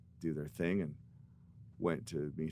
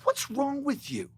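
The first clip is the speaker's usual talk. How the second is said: high, loud exasperated squeal; mock outrage at the friend; incredulous; 6 dB louder than usual. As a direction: demand it in disbelief. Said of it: "I'm looking at him going".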